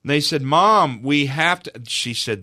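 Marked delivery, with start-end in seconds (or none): 0.4-1.7 loud, high insistent plea; retold childlike insistence, pitched up; persistent children. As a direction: press Mom hard as the pleading children. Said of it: "They were so persistent".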